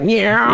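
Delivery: high geeky guitar-whine "nyow"; geeky noise imitation. Co-host mimics John headbanging to the guitar break, geeky wail. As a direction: squeal a geeky mock guitar bend. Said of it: "makes this so, so geeky noise".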